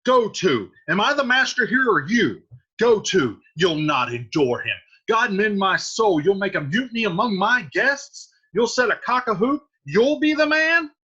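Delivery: loud, rising to a high shout; the host exploding at his nephew; furious Capulet. Acted. Capulet scolds Tybalt furiously.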